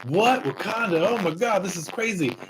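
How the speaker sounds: shocked disbelief. Retold or imitated. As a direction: gasp at it like you've never seen anything like it. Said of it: "the United States is like".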